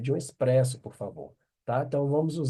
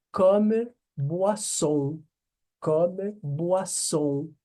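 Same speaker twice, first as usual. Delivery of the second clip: comme boisson stretched out very slowly; slow pronunciation demo; 4 semitones higher, much slower than usual. Shown as a demonstration. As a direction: draw out comme boisson syllable by syllable. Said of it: "teacher models phrase".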